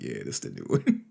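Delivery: very low, offhand answer; mock nonchalant reply.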